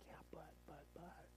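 whispered, faltering excuse; feeble objection. Acted. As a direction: barely whisper a protest to God. Acts feeble excuse to God.

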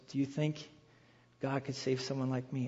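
very soft, low, halting question; hushed imitation of a man overcome; retold humble doubt. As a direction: ask it almost under your breath, unsure you qualify. Retold crying man's doubting question.

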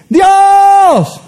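high, loud call to the sky; a small boy yelling up at heaven; child calling out. Retold or imitated. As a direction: shout "Dios" up like a boy at the car window. Child calls to sky from car.

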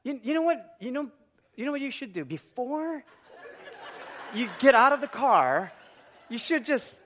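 high, teasing wife's advice; smiling, loving it. Retold or imitated. Wife throwing husband's lecture back at him, gleeful mock advice. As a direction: dole it out in halting, gleeful pieces. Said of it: "And she's smiling. She's loving this moment".

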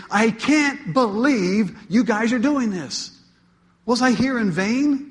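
an apostle begging his flock to see sense; desperate Paul. Acted. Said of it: "hear the desperation in Paul's voice".

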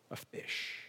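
a fish, whispered. Real, not acted. Preacher listing God's means, a whispered word. Unvoiced aside.

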